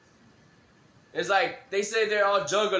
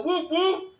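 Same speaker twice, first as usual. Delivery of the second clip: juggalo call; 11 semitones higher, 3 dB louder than usual. Retold or imitated. Calling out to ICP fan.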